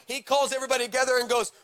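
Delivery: ordinary talk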